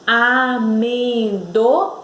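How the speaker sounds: "Amendoim" drawn out slowly, rising to im; stressed syllable demo. Shown as a demonstration. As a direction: sound out a-men-do, pause, IM for "Amendoim". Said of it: "o im deu um impulso".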